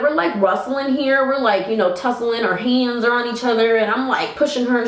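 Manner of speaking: ordinary delivery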